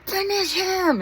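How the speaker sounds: loud high yell; shouting. Retold or imitated. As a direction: yell it out at the fight. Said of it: "shouting and screaming".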